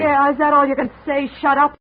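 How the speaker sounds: angry mocking shout. [name] mocks Jake by yelling his words back.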